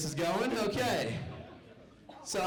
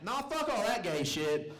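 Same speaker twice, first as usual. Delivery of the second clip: grandma from the back; 6 semitones higher than usual. Retold or imitated. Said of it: "I hear from the back".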